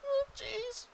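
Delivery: very high, small goblin squeak; goblin fretting. Acted. Goblins answering the player.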